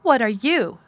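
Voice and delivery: indignant counter-question; quarrelsome comeback. A narrator's reading. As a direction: throw the accusation back sharply. Accusation thrown back in quarrel.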